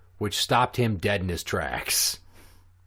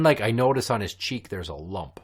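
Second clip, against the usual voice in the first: ordinary talk, nothing marked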